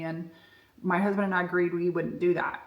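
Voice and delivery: ordinary delivery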